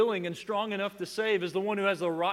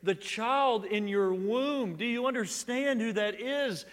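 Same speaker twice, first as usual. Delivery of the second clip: exultant declaration; 7 semitones higher, 5 dB louder, slower than usual. Retold or imitated. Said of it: "clearly enough to say".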